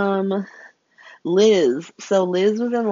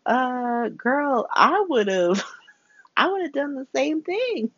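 sympathetic friend; 7 semitones higher, slower than usual. Retold or imitated. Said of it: "Terry's like".

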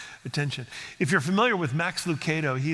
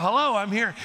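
lightly voiced child hello; 5 semitones higher, 3 dB louder than usual. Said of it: "he's getting into that".

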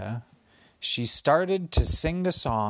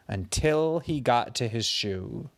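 plain reading voice

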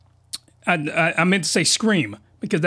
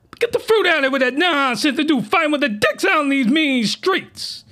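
voice cracking; 10 semitones higher than usual. Real, not acted. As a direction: voice cracks up high. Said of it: "Sorry for the voice crack".

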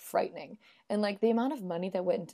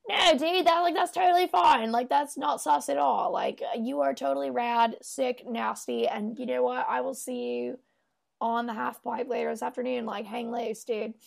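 mock frat-bro voice, pitched up at the start; hammed-up dude-speak, higher than usual, settling as it goes; frat boy dude; 4 semitones higher than usual. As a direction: play a slangy bro reassuring a buddy.

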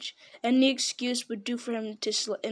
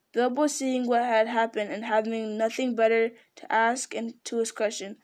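plain reading voice

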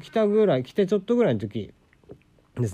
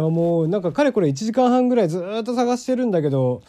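normal talking voice

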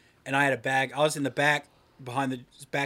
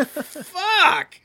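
much higher, louder anguished cry; defeated yell; 10 dB louder than usual. Retold or imitated. Boy reacting to a classmate's burn, anguished yelled curse. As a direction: cry it out like you got wrecked.

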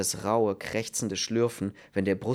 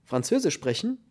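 incredulous echo, pitched up; astonished; 7 semitones higher than usual. A narrator's reading. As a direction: ask it back in disbelief. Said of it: "Der Mann blickte erstaunt hoch". (erstaunt und ungläubig)

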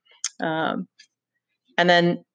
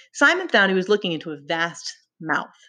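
ordinary talk, nothing marked

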